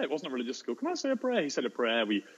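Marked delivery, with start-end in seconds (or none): 0.8-1.4 high, imitated kid's voice; small child. One kid asking teacher after the bad news, small child voice. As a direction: put on a pupil's high little plea. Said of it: "one kid said".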